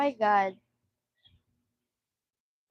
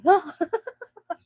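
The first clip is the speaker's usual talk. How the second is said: giggle; 8 semitones higher, 9 dB louder than usual. Real, not acted. Giggling after being moved to tears.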